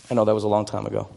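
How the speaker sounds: usual speaking voice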